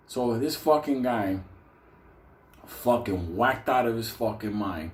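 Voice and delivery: ordinary talk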